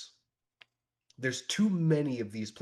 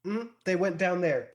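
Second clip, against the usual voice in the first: put-on higher, louder little kid voice; small child giving directions; child character; 8 semitones higher, 5 dB louder than usual. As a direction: answer as a helpful kid, pitched up. Game master voices the child.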